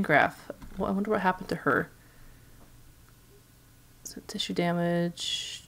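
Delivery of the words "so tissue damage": whispering. Real, not acted. Reading game note.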